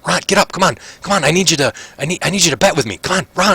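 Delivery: high, excited betting voice; rushing to lay a bet on the fight; eager gambler. Acted. Prisoner placing bets on the orc fight, excited and high.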